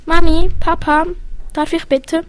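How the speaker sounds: eager little one calling to parents; pleading child. Acted. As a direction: call out high and hopeful like a small child. Child asks parents permission.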